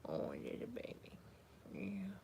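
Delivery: soft whispered coo; whispered tenderness to a little one. Real, not acted. Cooing over baby off camera.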